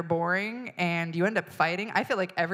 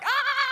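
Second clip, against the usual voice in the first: high, loud imitated toddler shriek; child's scream; 6 dB louder than usual. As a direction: shriek it like a furious baby.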